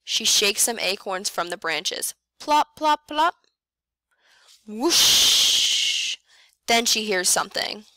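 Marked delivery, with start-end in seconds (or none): 2.4-3.3 high, louder plopping sounds; dropping plops. A narrator's reading. Acorns dropping. 4.7-6.2 a loud breathy whoosh of wind; windy whoosh. A narrator's reading. Snowstorm wind sound.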